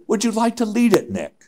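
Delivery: high mock Swiss accent, dropping on the name. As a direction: imitate a Swiss climber making an offer. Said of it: "he has his Swiss accent".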